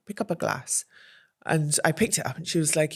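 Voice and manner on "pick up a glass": softer, quiet "Pick up a glass"; hushed instruction. Retold or imitated. Politician advising the mortified guest, softer. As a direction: quietly tell her what to do.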